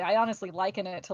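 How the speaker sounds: normal talking voice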